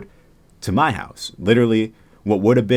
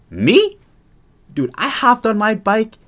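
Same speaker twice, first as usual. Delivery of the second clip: excited; 13 semitones higher, 5 dB louder than usual. Retold or imitated. Recounting fleeing in panic.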